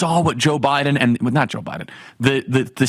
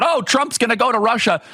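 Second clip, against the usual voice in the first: mock caterwauling pundit; 7 semitones higher than usual. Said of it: "start screaming and caterwauling about".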